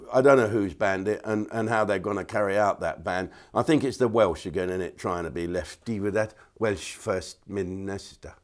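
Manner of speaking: normal talking voice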